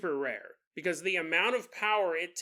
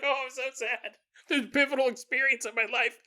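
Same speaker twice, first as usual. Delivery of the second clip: mock crying; 5 semitones higher, faster than usual. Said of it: "[name]'s all crying".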